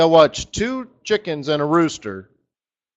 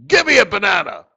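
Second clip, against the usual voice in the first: loud, pushy rooster voice; demanding rooster; 5 semitones higher, 7 dB louder than usual. Acted. Rooster at the bar demanding a banana, loud and pushy. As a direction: rooster barking his order at the bartender.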